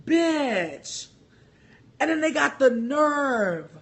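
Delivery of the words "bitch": incredulous bitch. Retold or imitated. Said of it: "and I was like".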